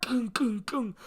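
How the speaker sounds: vocal gavel knocks; gavel knock sound. Pretend. Judge knocking for order, gavel knocks. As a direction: bang a gavel with your mouth.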